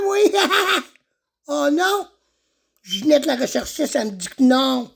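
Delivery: high, excitable son character; Jérémy. Acted. Farmer's son announces his birthday, high and excitable.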